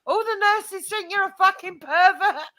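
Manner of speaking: high, loud exasperated outburst; retold exasperated cry. Wife telling off her husband, high and exasperated. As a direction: throw it at him in disbelief.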